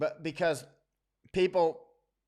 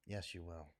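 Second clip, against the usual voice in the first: much softer, lower repeat; quieter insistence; 8 semitones lower, 15 dB quieter than usual. Real, not acted. Answering future generations, barely above a murmur. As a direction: let it sink to almost nothing.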